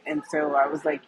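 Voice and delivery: ordinary delivery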